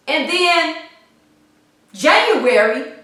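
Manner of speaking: usual speaking voice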